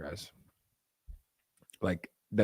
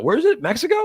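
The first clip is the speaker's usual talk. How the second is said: high, incredulous squeak of a question; mock surprise; 13 semitones higher, 4 dB louder than usual. Streamer mocking friends who push Mexico trips, high incredulous question. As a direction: play dumb, pitch it way up in disbelief.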